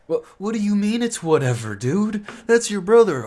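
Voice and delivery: high, loud, outraged comeback; outraged retort. Retold or imitated. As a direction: shout back at the careless brother. Friends snap back at brother.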